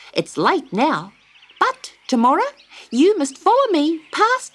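high, emphatic bird voice; busy little wagtail. A narrator's reading. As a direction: give directions like a fussy bird.

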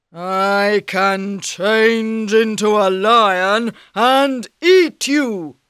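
loud, slow ogre threat; menacing ogre. Acted.

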